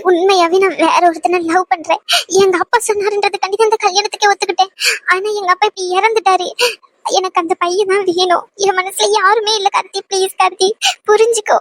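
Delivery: begging through tears; tearful pleading. Acted. Woman begging Karthi to let her go, tearful pleading. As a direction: plead desperately to be understood.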